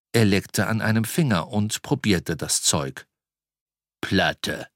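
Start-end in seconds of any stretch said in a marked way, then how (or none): 4.0-4.7 low troll growl. Acted. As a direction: growl the word low and short. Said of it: "knurrte er".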